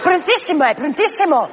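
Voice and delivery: mock the friend who won't leave; party friend voice. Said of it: "y hace".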